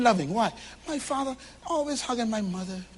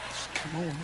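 quieter, seductive coax from the wife; softer wife coaxing; 7 semitones lower, 10 dB quieter than usual. Retold or imitated. Pastor voicing a wife inviting her husband to bed, hushed coax. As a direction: lure him in, hushed and sweet. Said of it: "she say".

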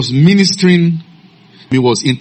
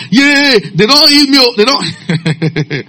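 imitating joyful screaming; 11 semitones higher, slower than usual. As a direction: cry out high like the healed boy. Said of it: "he was screaming from there".

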